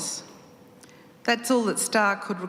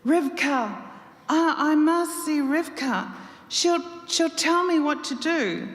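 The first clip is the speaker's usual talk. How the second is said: high, teary pleading with little catches; hiccuping through tears; 8 semitones higher than usual. A narrator's reading. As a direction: sob it out, begging to see Rivka. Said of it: "Starr hiccuped between tears".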